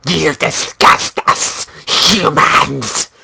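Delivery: harsh, throat-straining vulture voice; strained, disgusted creature rasp. Acted. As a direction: spit contempt at the humans as a vulture. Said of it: "I can try a vulture".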